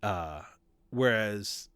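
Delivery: normal talking voice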